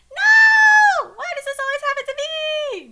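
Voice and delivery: high, loud wailed shout; hammed-up wail of despair; crying out. A narrator's reading. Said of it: "Joe Biden cries".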